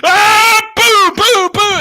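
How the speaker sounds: very high, loud hooting bird call; imitated bird call. Teammate making bird calls to hype Birdsong, shrill and loud. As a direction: hoot like a locker-room bird call. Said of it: "the next guy be like".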